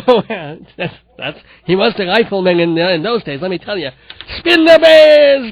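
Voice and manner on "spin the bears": loud, high, drawn-out call; stretched-out showman's cry. Pretend. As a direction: holler it slowly like a game-show chant. Calling out to start the spin.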